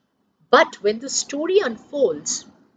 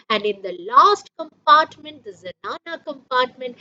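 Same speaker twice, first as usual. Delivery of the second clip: ordinary talk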